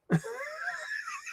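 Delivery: breathless squeal of a laugh; tickled soft squeal; high squeaky giggle. Real, not acted. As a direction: crack up into a tiny high giggle. Giggle after joke.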